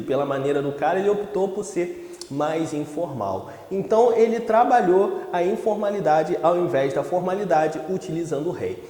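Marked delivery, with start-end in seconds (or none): none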